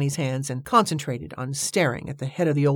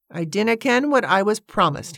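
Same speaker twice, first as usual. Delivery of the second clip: stubborn Scottish brogue, raised in pitch; obstinate Scottish; 6 semitones higher than usual. A narrator's reading. Man refuses his promise, obstinately Scottish. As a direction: dig your heels in with a Scottish accent. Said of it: "obstinately Scottish".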